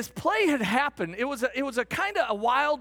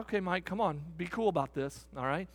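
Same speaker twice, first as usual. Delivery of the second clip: low, soft self-talk; talking himself down; 4 semitones lower, 6 dB quieter than usual. Retold or imitated. Fan calming himself in the stands, low and soft. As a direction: quietly tell yourself to keep cool. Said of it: "I just thought".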